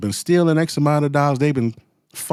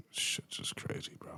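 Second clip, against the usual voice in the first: breathy hushed whisper; 20 dB quieter than usual. Real, not acted. Aside to viewers after a sigh, whispered. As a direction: mutter it under your breath in disbelief.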